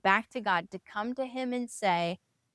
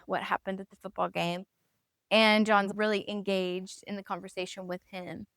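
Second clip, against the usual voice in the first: ordinary talk, nothing marked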